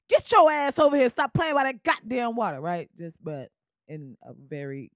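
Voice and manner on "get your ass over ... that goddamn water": put-on louder, higher scolding call to kids; mock parent yelling. Beach parent yelling at kids, mock shout. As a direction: holler at the kids by the water. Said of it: "the way I translated was".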